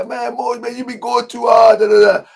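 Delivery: mocking singsong of someone's objection; mock complaint. Imitating someone's complaint about him.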